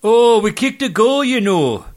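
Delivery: high, loud mock-Scottish footballer; bad Scottish accent. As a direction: put on a hammy Scottish accent. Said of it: "your Scottish accent".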